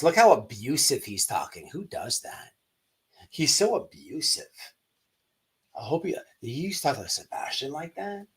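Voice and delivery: imitating a preachy YouTuber, lightly voiced; mock YouTuber. Imitating YouTubers spinning the recording, lightly mocking. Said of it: "I bet you something like this".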